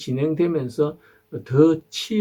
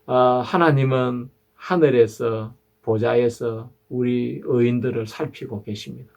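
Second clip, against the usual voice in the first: ordinary talk, nothing marked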